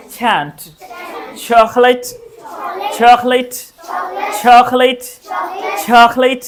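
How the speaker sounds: चैंपियन with breathy छ and puffed P; चॉकलेट as "chhokh-lait", slow model words; native English aspiration. Shown as a demonstration. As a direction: drill CH as छ, with a puff. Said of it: "तो ये हुआ native English".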